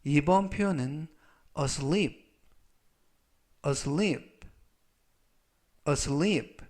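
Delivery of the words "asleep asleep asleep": asleep said three times, each one clear and careful; model pronunciation. Shown as a demonstration. Teacher models asleep for students, careful and clear. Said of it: "라고 발음하시면 되겠고요".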